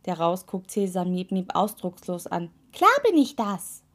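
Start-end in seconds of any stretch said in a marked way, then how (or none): 2.7-3.8 high, loud, self-assured toe voice; cocky toe. A narrator's reading. Toe replying to the witch's praise, cocky. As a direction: answer praise smugly in a high voice.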